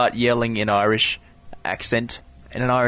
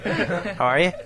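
high mock Irish greeting, laughing; playful imitation of an Irish lilt; rising, jokey Irish accent; put-on Irish accent; 6 semitones higher, slower than usual. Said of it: "That's not a bad accent".

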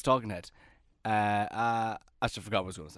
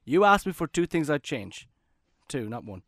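ordinary talk, nothing marked

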